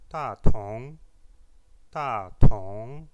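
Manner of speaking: ta tong: ta falling sharply, tong rising; falling then rising tones. Shown as a demonstration. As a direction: drop hard on ta, rise on tong, said loudly. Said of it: "ta a un quatrième ton". (ta au quatrième ton, tong au deuxième)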